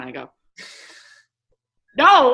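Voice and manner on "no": a loud high emphatic no. Retold or imitated. Player to coach, a loud emphatic no.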